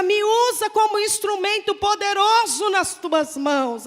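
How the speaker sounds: loud, pleading prayer; fervent prayer. Acted. Pastor leading the church in loud prayer to the Lord. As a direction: cry out to God with rising fervor.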